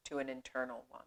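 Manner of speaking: unhurried meditation voice with long pauses; measured, spacious yoga-teacher delivery; guided meditation. Acted. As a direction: guide them gently inward, leaving space after each phrase.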